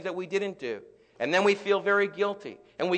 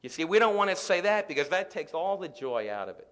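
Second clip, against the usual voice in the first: ordinary talk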